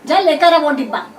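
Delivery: exasperated mother yelling; loud, fed-up shout; angry screaming. A narrator's reading. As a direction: yell it loudly to get rid of a pestering child. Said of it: "चीखते चिल्लाते हुए कहा".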